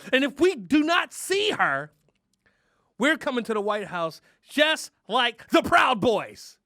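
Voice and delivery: loud, slow, high threat; mock ultimatum. Comic threatening Biden over Harris, loud mock ultimatum. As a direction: rant like a protest leader.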